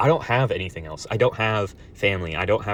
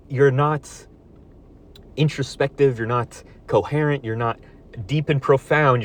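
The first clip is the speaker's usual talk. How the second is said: angry berating; 5 semitones higher, 4 dB louder than usual. Real, not acted. Berating imagined opponent.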